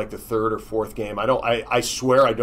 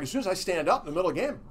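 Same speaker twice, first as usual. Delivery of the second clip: fast retold worry; 7 semitones higher, faster than usual. His anxious thought retold.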